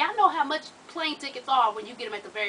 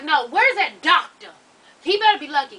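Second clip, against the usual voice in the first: angry; 3 semitones higher, 8 dB louder than usual. Retold or imitated. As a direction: threaten the absent doctor. Angry retort in story.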